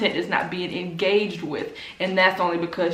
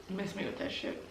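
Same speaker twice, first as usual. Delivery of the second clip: quiet dismissal; 15 dB quieter than usual. Real, not acted. Dismissing their excuses.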